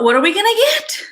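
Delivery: mock bewilderment, rushed and rising; imitating baffled outsiders. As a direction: voice a puzzled onlooker, pitch climbing sharply. Voices confused outsiders asking.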